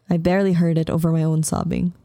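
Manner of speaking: ordinary delivery, nothing marked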